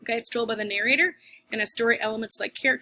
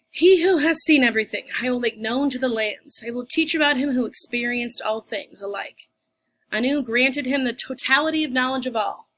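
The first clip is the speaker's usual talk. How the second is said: grand epic recitation; 5 dB louder than usual. Acted. Teacher showing the class an epic, in a loud grandiose declamation. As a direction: proclaim it like an ancient bard.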